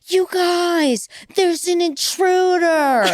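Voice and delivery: high-pitched mock shriek; imitated shriek. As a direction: squeal it like an alarmed roommate, drawn out. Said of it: "I heard the roommate shriek and be like".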